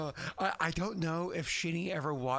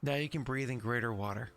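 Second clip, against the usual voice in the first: normal talking voice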